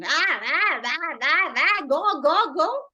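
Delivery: loud screaming "ah", then shoo "go, go"; boy screaming. Retold or imitated. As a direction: scream to chase the duck away. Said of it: "And the boy was screaming".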